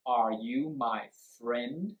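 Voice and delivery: slow, with "friend" gliding upward; rising question tune. Shown as a demonstration. Teacher demonstrating yes-no questions, slow rise on "friend". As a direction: lift the pitch steadily through to "friend". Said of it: "So it goes up".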